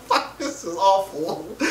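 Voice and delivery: slow words squeezed out through laughter; real laughter under the words; laughing quote. Real, not acted. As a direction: say it while still cracking up. Laughing at the awful picture.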